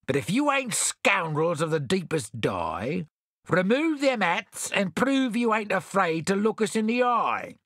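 high, slow scornful challenge; Bill sneering. A narrator's reading. Bill sneers at the strangers, high and scornful. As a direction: dare them to unmask. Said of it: "sneered Bill".

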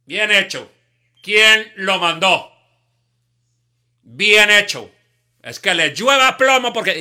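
loud high vengeful gloating, slow. Retold or imitated. Listener cheers a young man's death, loud vengeful gloating. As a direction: savour the violence out loud.

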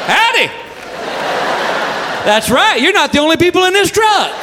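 high, yelled interruption; exasperated dad. Acted. Dad interrupts wife and daughter in the truck, exasperated. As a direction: call out in protest from the driver's seat.

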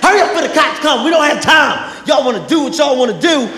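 high, urgent scolding shout; hyped-up yelling. Retold or imitated. Nate hustling Kev into the car, hyped and scolding. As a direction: rush him and scold him. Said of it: "He was hype as shit".

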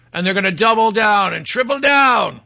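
ordinary talk

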